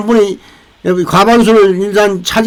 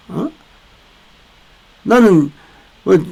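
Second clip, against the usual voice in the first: ordinary talk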